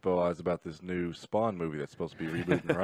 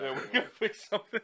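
laughing; 11 semitones higher, 4 dB quieter than usual. Real, not acted. Laughing after the rant.

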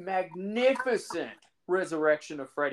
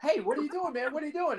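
startled call; 12 semitones higher, faster than usual. Retold or imitated. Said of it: "finds his dog and goes".

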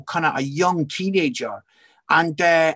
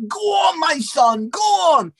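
high-pitched cheering dad in the crowd; retold shout of encouragement from the sidelines; 10 semitones higher than usual. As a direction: cheer him on with a high, urgent call. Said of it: "he starts shouting and clapping and cheering".

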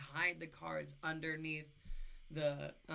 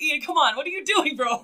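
higher, louder panicked pleading; panicked yell; 8 semitones higher, 16 dB louder than usual. Retold or imitated. Sister yelling at her brother as the truck swerves, panicked. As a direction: yell at him to stop swerving.

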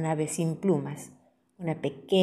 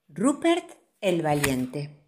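usual speaking voice

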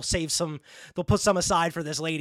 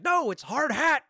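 high, drawn-out mock protest; slow, high-pitched acted exasperation; exasperated correction; 9 semitones higher, slower than usual. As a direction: stretch out the hammed-up correction. Same correction retold, more exasperated.

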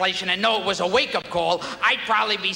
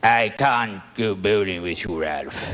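much lower, grave Norton; Richard Burton as Norton; 14 semitones lower than usual. Retold or imitated. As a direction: deliver Norton's excuse like a stage actor. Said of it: "Richard Burton as Norton".

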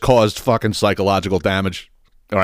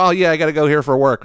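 higher, airy fake excuse; mock breezy excuse; 6 semitones higher, faster than usual. Mom brushing off the question, lightly voiced breezy excuse. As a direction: toss off the lie lightly.

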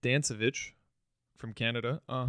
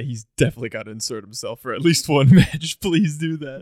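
excited laughing plea; 2 semitones higher, 11 dB louder than usual. Real, not acted.

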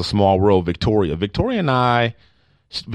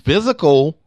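"physical" punched higher than usual; stress on physical; 6 semitones higher than usual. Real, not acted. Host reading the question of the week, stressing "physical". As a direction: lean hard on the word "physical". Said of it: "emphasis on physical".

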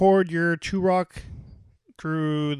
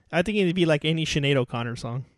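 ordinary talk

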